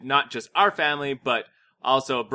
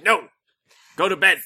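parents ordering; 5 semitones higher, 6 dB louder than usual. Retold or imitated. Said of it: "and we're like".

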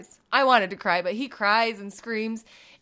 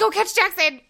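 urgent call; 6 semitones higher, 4 dB louder than usual. Retold or imitated. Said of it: "'cause I said".

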